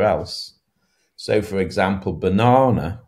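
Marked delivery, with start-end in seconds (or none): 2.1-3.0 ba-NA-na, weight on the middle; "banana" with a weak start and strong middle; middle-syllable stress. Shown as a demonstration. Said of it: "has stress on the middle syllable".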